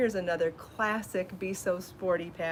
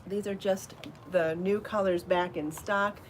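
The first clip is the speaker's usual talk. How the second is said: usual speaking voice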